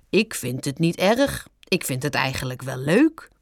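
low voice lilting up at the end; skunk character. A narrator's reading. Skunk pleased with his new name, coy. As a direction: admit coyly that you like it.

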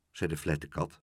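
plain reading voice

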